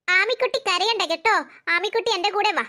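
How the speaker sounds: usual speaking voice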